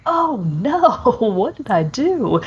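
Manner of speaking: high, laughing "oh no" of sudden realization; mock dismay. Gamer realizing the day is gone, mock panicked and laughing. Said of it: "and you're like".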